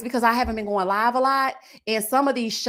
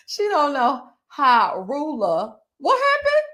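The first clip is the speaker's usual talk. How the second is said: laughing confusion; 4 semitones higher, 3 dB louder, slower than usual. Real, not acted. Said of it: "I'm not understanding".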